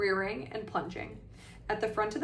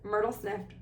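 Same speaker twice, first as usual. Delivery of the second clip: usual speaking voice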